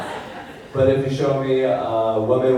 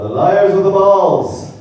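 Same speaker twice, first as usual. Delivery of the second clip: award announcer; 8 semitones higher, 7 dB louder, slower than usual. Pretend. Announcing a category.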